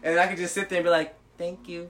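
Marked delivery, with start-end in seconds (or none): 1.4-1.9 mock-timid soft thank you; sheepish thanks. Man in the stall thanking whoever turned the lights on, softly sheepish. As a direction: say it small and quiet, half laughing.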